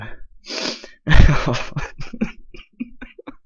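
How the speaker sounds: high laughing fit that runs out of breath; real helpless laughter. Real, not acted. Host with aching stomach and jaw, helpless laughter. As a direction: crack up until it hurts. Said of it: "my fucking stomach and jaw hurts".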